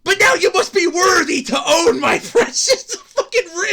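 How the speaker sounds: high, loud grand ring-lord; mock villain. Streamer mocking NFT owners as a ring-lord, loud grand mock. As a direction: proclaim it with mad pride. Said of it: "like the one ring of Sauron".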